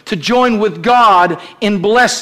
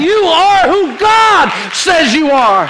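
preached declaration; 7 semitones higher, 3 dB louder, slower than usual. Acted. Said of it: "by saying".

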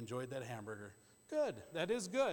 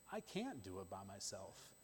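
much softer and lower, almost under the breath; bow low and admit it quietly; humble quiet admission; 6 semitones lower, 10 dB quieter than usual. Retold or imitated. Preacher voicing a humbled believer, soft and low. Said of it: "humble yourself and say".

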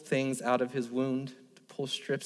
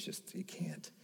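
breaking whisper; 15 dB quieter, slower than usual. Real, not acted. Dad tells of son's surgery.

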